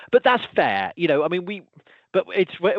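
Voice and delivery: usual speaking voice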